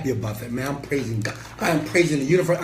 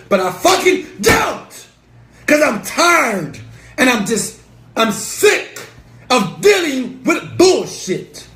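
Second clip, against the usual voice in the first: imitated fed-up yell; 10 semitones higher, 7 dB louder, slower than usual. Acted follower message, building rage.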